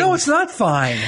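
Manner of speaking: high, firm protest; indignant. Real, not acted. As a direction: push back, outraged. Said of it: "Oh, that's fine".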